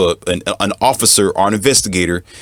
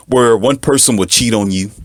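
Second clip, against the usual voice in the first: ordinary delivery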